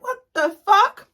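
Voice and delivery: drawn-out shocked outrage; stunned disbelief. Retold or imitated. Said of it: "And I'm like".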